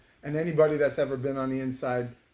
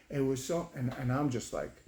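plain reading voice

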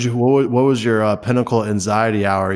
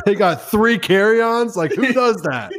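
high, slow disbelieving laugh-talk; mock the guests through laughter; incredulous laughing; 11 semitones higher, slower than usual.

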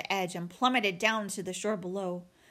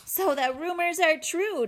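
higher, gleeful jeer; scornful bear sister's delight; mocking amusement; 8 semitones higher, 3 dB louder than usual. A narrator's reading. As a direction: taunt as if amused, pitched up. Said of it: "Nettle laughed".

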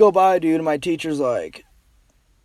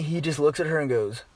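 ordinary delivery

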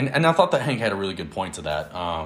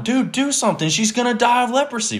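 pleading, pitched way up; mock panic; 14 semitones higher than usual. Aaron begging Moses, panicked and pitched way up. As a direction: urge him to act now.

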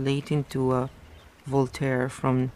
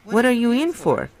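a lightly voiced startled poet, pitched up; voicing Ampleforth; 9 semitones higher, 5 dB louder than usual. Reader voicing Ampleforth greeting Smith, lightly raised and surprised. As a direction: greet him with mild surprise.

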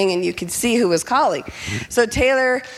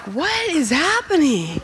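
slow, high, drawn-out disbelief; baffled; 6 semitones higher, slower than usual. Retold or imitated. Mom reacting to son's outburst, acted bafflement. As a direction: swoop up high in bewilderment.

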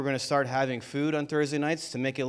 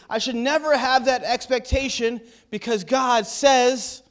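higher-pitched, emphatic sermon delivery; impassioned preaching; 8 semitones higher than usual. Real, not acted. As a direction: preach it with rising conviction, pitched up. Preaches at full force.

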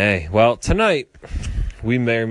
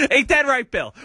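high playful call; 3 dB louder than usual. Acted. Calling out to guest.

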